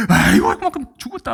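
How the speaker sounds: a hammed-up panicked 아이고; panicked fuss. Preacher imitating a bitten man's panic, mock wail. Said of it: "막 야단법석".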